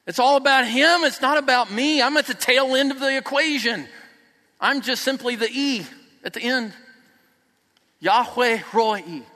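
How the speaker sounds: loud, high impassioned preaching; preaching at full force. Real, not acted. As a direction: drive it home to the congregation.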